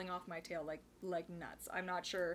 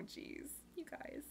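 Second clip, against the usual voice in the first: whisper; 6 semitones higher, 13 dB quieter, slower than usual. Real, not acted. Touched by sleepless viewers.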